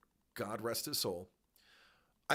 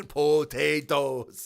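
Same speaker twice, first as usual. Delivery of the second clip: slow, loud drawn-out potatoes; a hammed-up slow answer about Irish food; mock deadpan answer; 10 dB louder, much slower than usual. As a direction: stretch it out like it's the whole menu. Mocking reply about Irish food.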